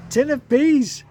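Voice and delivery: very high, loud mishearing shout; deaf man's shout. Retold or imitated. Deaf postmaster shouting back his mistaken guess. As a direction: yell the wrong words proudly.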